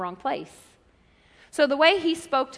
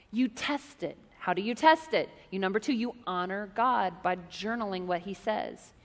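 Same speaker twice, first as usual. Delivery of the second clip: ordinary talk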